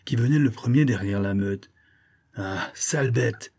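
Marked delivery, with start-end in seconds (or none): 2.3-3.5 slow, drawn-out insult; slow threat, storyteller's character voice; menacing friar. A narrator's reading. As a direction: curse the beast slowly. Friar catches the fox.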